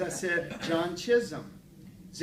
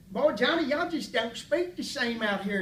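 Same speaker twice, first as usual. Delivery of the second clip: high, loud country-character impression; hammed-up small-town voice, pitched up; Gene Rader; 8 semitones higher, 4 dB louder than usual. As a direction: imitate an eccentric Texan chatting up a talk show host. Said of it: "And he said".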